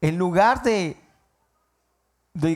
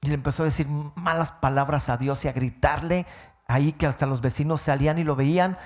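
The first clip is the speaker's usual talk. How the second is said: ordinary talk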